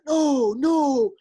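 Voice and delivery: high, excited rowdy fan voice; mock-friendly New York fan, pitched well up. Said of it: "the guy's like".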